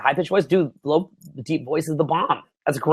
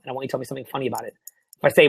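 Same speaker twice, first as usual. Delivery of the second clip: ordinary talk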